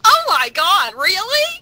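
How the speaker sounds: high, loud gasp of delight; excited surprise. Real, not acted. Cast member reacting to a friend's big casting news, excited. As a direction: squeal it like thrilling news.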